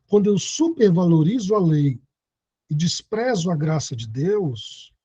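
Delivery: ordinary talk, nothing marked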